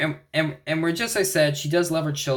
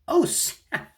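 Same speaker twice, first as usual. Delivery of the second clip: high, breathy squeal of oh snap; sudden high mock gasp; mock shock. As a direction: gasp it out like a stunned fan. Mock reaction to head smash.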